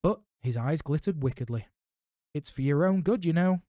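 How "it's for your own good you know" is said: mock saintly. A narrator's reading. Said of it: "in a saintly voice".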